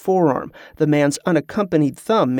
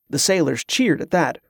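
ordinary delivery, nothing marked

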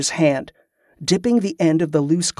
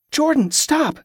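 high, urgent plea to stop; pleading; 11 semitones higher than usual. A narrator's reading. Carla pleading with Jordan to stop. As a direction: cry out to halt him. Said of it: "Carla pleaded".

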